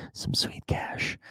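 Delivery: slow whispered sweet cash. Acted. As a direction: whisper it like a secret. Whispered money tease.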